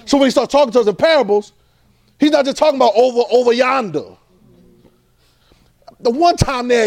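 rising sermon fervor; preaching. Acted. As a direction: preach it out with full force. Preaching at full force.

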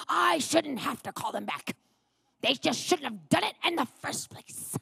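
put-on, worked-up protest; mock frustrated rant. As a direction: imitate an indignant husband. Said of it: "frustrated and worked up".

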